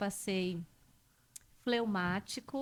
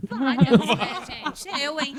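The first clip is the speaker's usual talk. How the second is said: loud, high-spirited talk through laughter; rindo alto; 4 semitones higher, 13 dB louder than usual. Real, not acted. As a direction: laugh while owning up, louder than usual. Reage rindo à pergunta sobre palavras. (falando alto entre risadas)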